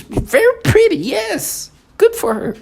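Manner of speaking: high, squeaky mimicking voice, rising as it goes; mocking falsetto. Mocking the people he hears in a squeaky high voice. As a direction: parrot them back in a silly high voice.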